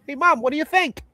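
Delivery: high mock mom voice; quoting the mom. Quoting mom scene from Goodfellas.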